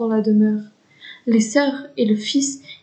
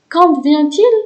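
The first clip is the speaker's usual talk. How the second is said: eager question; 4 semitones higher, 7 dB louder, slower than usual. Acted. Anna asks eagerly.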